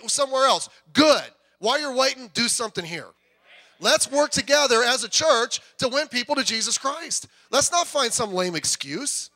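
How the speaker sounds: loud, high, driving preacher; rally the congregation with fire; fiery pulpit exhortation; full-force preaching. Acted.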